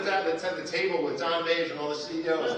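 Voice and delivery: normal talking voice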